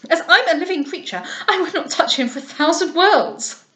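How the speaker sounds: near-frantic disgust; vexed refusal. A narrator's reading. Lovell refusing to touch the monkey, high and vexed. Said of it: "almost mad with vexation".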